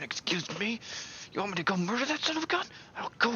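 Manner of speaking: high, fast mock rage; mock furious villager. Villager offering to murder the interference, hammed-up rage. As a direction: rant like a tiny furious villager.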